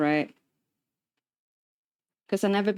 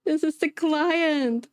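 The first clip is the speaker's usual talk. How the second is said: higher, slow alarmed repeat; alarmed realization; 6 semitones higher, slower than usual. Retold or imitated. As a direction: realize the stakes with panic. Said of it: "repeated with alarm".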